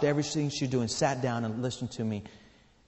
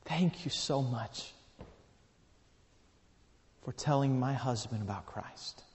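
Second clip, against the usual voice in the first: moved gratitude; 7 dB quieter than usual. Retold or imitated. Widow thanking the man who told her husband, softly moved. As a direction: let each phrase land gently, with a long pause.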